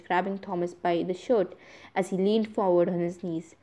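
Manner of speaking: usual speaking voice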